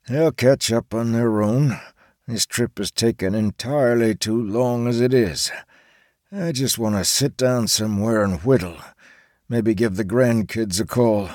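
put-on tired old man grumbling; grumpy old man. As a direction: mutter like a weary grandpa. Charles grumbles to himself.

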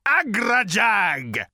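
high, slow, roaring fury. A narrator's reading. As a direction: roar out the threat. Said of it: "roared the voice".

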